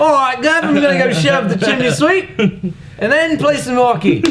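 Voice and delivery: put-on high, loud Cockney Dickensian accent; Dickensian British accent. Mocking Dickensian hockey players in a high Cockney accent. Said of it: "Dickensian fucking hockey players?".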